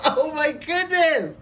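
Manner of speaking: high, slow, laughing oh my goodness; real giddy laughter. Real, not acted. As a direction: laugh through the words in delight. Overjoyed by the card reading.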